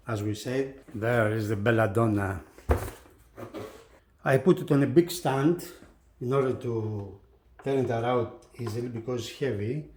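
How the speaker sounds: usual speaking voice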